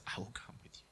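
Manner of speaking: breathy whispered promise. Acted. God whispering his promise to the fearful man, whispered. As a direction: breathe it barely aloud.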